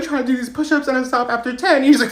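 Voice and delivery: ordinary talk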